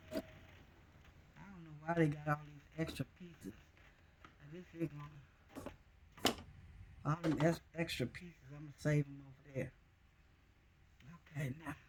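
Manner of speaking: holding breath, words barely voiced. Real, not acted. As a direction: talk while holding your breath. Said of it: "I'm holding my breath, y'all".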